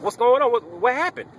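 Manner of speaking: mock-panicked demand for answers; alarmed questioning. Patient demanding to know what happened, alarmed.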